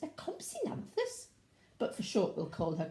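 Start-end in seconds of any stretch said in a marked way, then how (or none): 0.0-1.3 high little voice. Acted. Reading dinosaur name, high voice.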